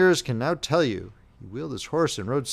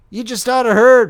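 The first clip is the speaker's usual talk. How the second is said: louder, more insistent; eager repeat; 10 semitones higher, 13 dB louder than usual. A narrator's reading. Soldier insisting the youth listen, louder and eager. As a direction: press it even harder. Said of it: "repeated the other".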